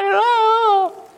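high whiny moan; whiny mock groan.

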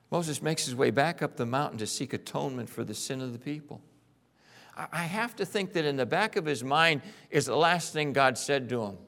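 ordinary delivery

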